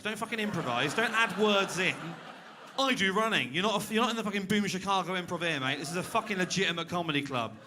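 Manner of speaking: a comedian's hammed-up tirade at a heckler; mock rant. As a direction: tell him off, half joking. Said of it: "Sorry, that took a turn".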